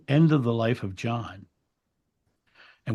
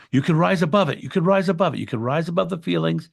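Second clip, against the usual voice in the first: imitating an insistent therapist, higher and louder; urgent coaxing; 7 semitones higher, 6 dB louder than usual. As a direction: urge them upward in a raised, repeated plea. Therapist urging her above overwhelming feelings.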